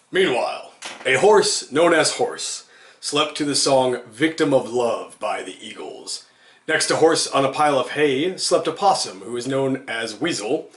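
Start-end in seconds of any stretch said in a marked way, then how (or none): none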